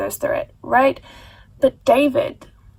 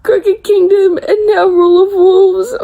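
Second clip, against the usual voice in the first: crying; 6 semitones higher, 6 dB louder than usual. Real, not acted. Grieving a killed character.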